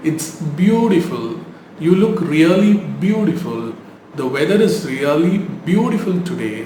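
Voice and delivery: lilting compliment, beautiful as BYOO-di-ful; heartfelt, expressive. Shown as a demonstration. Teacher modelling a sincere compliment, heartfelt and expressive. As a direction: swoop up on the praise words. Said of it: "மனதார சொல்ற மாதிரி".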